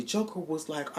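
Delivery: ordinary talk, nothing marked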